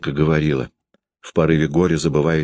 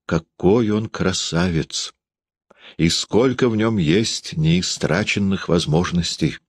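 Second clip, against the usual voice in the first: slow, pensive admiration; slow and dreamy; slower than usual. Acted. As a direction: linger on each word, lost in thought. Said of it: "задумчиво и медленно ответила".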